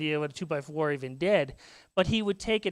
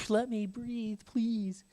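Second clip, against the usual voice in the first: softer, weary sigh of a plea; tired plea; 4 semitones higher, 6 dB quieter, much slower than usual. Retold or imitated. Tired dad asking his boys for space, soft weary plea. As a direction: beg for a moment's peace, softly. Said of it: "I just go".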